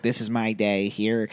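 low, soft, slow vlog voice; mock day-in-the-life narration; vlogger imitation. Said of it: "vlog type thing where he's like".

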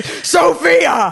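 drawn-out shouted name, like an angry mom; mock hellish yell. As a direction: imitate a mother roaring from the pits of hell. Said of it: "a voice from the fiery pits of hell".

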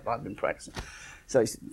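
usual speaking voice

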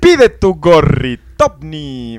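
slow, loud hard-sell voice; infomercial announcer. Acted. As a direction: push the product like a TV ad. Mock ad pitch for caps.

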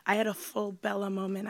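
ordinary talk, nothing marked

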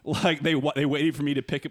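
ordinary talk, nothing marked

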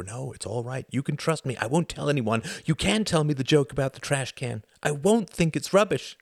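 put-on a small boy begging to be told; pleading little boy. A narrator's reading. Little boy following him all day, pleading and eager.

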